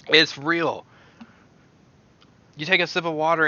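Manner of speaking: loud high amazed praise; excited exclaim. Real, not acted. Vlogger amazed by the toothpaste, loud excited praise. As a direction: rave that it's real.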